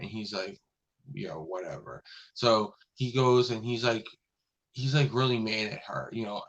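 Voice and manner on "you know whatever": low, soft "whatever"; retold dismissive. Scientist told to drop his experiment, quietly dismissive. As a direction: brush it off under your voice. Scientist brushes off captain's order.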